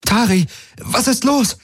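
high, alarmed call; calling over noise. A narrator's reading. Kirai calls to Tari over sirens, alarmed. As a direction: pitch it high to carry over the din. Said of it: "rief sie über den Lärm".

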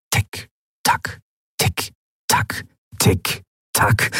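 breathy tick-tock whispered like a clock. A narrator's reading. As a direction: tick it off softly. Clock ticking in his head.

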